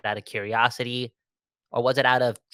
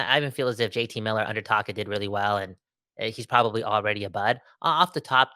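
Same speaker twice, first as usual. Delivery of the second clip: normal talking voice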